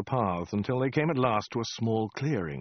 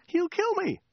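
very high squeal; frightened woman. A narrator's reading. Woman to the prompting soldier, high frightened squeal. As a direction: protest in a falsetto squeak.

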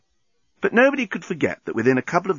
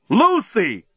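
high, loud cry of her name; shocked exclamation; 9 semitones higher, 5 dB louder than usual. A narrator's reading. Dan catching Lucy with the journalist, shocked cry. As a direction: call out in shock, falling at the end. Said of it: "exclaimed Dan".

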